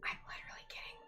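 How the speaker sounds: soft, breathy whisper. Real, not acted. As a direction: whisper it like a secret. Secret plotting whisper.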